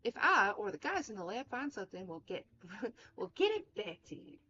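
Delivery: Southern twang breaking into a laugh; put-on friendly Southern accent; lilting Southern character voice; Mary Sason. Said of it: "I don't know why she's Southern".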